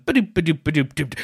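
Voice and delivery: voicing an instrumental intro as doops; imitated piano glissando. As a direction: sing out a bouncy piano riff in syllables. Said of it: "piano glissando".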